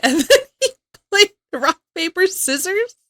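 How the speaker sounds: can barely get it out for laughing. Real, not acted. Laughing at husband's sleep talk.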